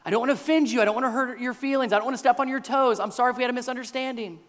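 hurried, over-accommodating apology; caving under pressure. Acted. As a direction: rush to appease, apologising and offering. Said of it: "He could easily cave under this pressure".

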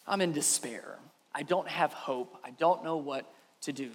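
low, subdued voice of despair; quiet despair. Acted. Desperate woman crying out to the prophet, hushed despair. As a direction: sink softly into hopelessness, pitched low. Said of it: "cries out".

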